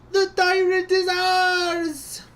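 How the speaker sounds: put-on slow, high, booming Russian-accented victory cry; triumphant Russian shout. Radio operator announcing the tyrant's capture, slow Russian roar. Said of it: "my Russian accent".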